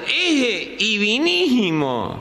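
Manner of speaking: very slow, swallowed consonants; gangoso imitation. Pointing out the bird to the seller, in a mock gangoso voice. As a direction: stumble through it like a nasal speaker. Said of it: "el gang".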